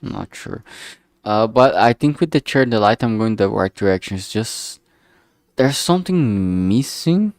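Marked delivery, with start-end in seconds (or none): none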